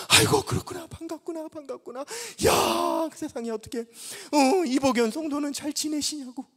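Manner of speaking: high, glad exclamations; relieved delight. Retold or imitated. Relieved old pastor greeting the young man happily. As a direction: welcome him warmly and loudly. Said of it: "안심이 된 거죠".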